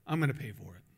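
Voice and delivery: low, soft, firm statement; quiet refusal. Retold or imitated. Customer declining the cashier's free food, quiet refusal. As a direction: drop the voice and turn it down.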